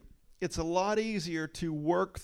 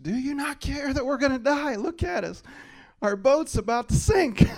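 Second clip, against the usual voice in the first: much higher, louder mock panicked shout; accusing shout; 10 semitones higher, 8 dB louder than usual. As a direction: yell at Jesus like the scared disciples. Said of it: "Jesus in a situation being accused".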